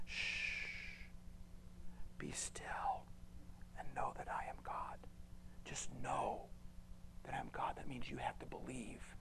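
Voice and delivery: soft hushed whisper; gentle whispered reassurance. Acted. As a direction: hush them like a calming parent. God hushing, be still.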